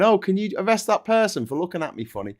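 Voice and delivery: high, whiny pleading voice; mock a whining complainer; whiny mock plea. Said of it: "They're going".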